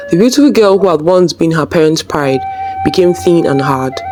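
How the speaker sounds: ordinary delivery, nothing marked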